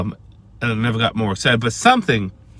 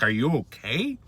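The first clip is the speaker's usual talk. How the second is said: softer, a lightly voiced concerned wife; worried wife; 7 dB quieter than usual. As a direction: ask it like a wife checking on a noise. Wife calls from another room.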